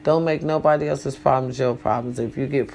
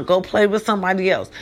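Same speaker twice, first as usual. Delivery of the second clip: normal talking voice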